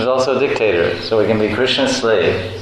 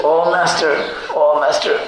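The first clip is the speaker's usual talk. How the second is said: higher pleading slave voice; 8 semitones higher than usual. Acted. Slave begging master for a day off, higher plea. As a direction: beg the master timidly. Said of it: "The slave says".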